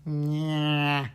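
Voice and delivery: a long, held nasal "nya"; nasal puppet sound. Shown as a demonstration. Teacher demonstrating a puppet voice's core sound, very nasal. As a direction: drone it from back of nose and throat. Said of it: "just use that core sound".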